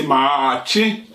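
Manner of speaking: ordinary talk, nothing marked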